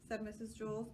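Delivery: ordinary delivery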